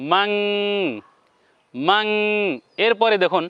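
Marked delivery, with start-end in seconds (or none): 0.1-2.0 মাং said with a held nasal ng at the end; nasal ending demo. Shown as a demonstration. Teacher shows viewers how মাং ends on a nasal ng. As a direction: sound out মাং, ending on a ringing ng. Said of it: "আরেকটা আকারে উচ্চারণ হবে অনুস্বার".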